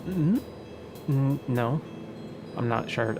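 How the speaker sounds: ordinary talk, nothing marked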